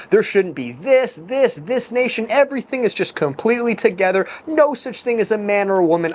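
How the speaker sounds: mocking. Mocks egalitarian viewpoint.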